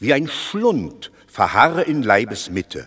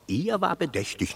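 ordinary talk, nothing marked

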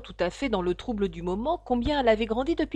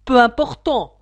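much louder, hurried "Peu important"; hasty retort; 2 semitones higher, 12 dB louder than usual. A narrator's reading. The king hastily takes up the rabbit's correction, loud. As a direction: snap back the correction in a hurry. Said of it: "répliqua le roi avec empressement".

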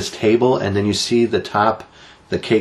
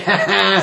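high mock "ha ha"; imitated taunting laugh; 10 semitones higher than usual. Driver taunting the woman filming, mock laugh. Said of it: "he's like".

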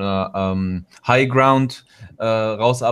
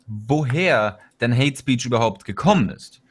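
ordinary talk

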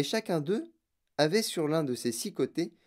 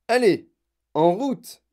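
loud, high rallying call; départ lancé; 7 semitones higher, 9 dB louder, slower than usual. A narrator's reading. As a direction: urge everyone onward. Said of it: "dit monsieur Wonka". (lancer le départ d'une voix forte)

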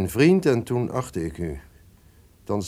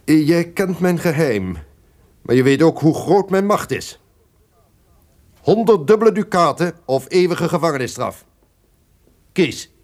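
menacing ultimatum; 9 semitones higher, 7 dB louder than usual. Acted. Duke threatens servant with ultimatum.

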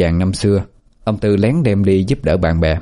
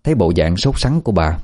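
ordinary delivery

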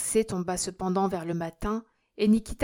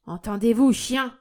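loud, higher angry insult; threatening cry; 3 semitones higher, 7 dB louder than usual. A narrator's reading. Old miser threatens his children, shouting. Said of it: "s'écria-t-il en menaçant ses enfants".

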